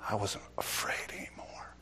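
breathy hushed whisper. Real, not acted. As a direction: drop to a whisper for effect. Dramatic hushed confession.

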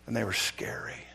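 breathy hushed whisper. Real, not acted. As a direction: whisper it like a secret. Hushed dramatic admission.

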